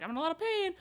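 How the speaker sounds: playing up the pain to the group; whiny quote. Friend retelling camp, mock pained whine. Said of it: "but I'm like".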